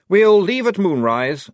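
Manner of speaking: high, loud officer's order; commanding. Acted. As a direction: announce it as the officer.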